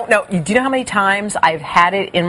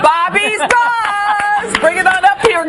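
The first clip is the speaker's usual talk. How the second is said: high, drawn-out segment call; segment announcement; 11 semitones higher, slower than usual. Real, not acted. Host summons Bobby for her segment, showy announcement. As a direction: sing out the segment name, stretching it.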